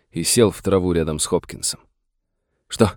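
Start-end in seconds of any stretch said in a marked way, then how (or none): none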